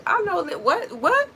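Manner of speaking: high, loud, baffled what; incredulous reaction. Acted. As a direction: act out someone taken aback. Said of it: "they might look at you crazy".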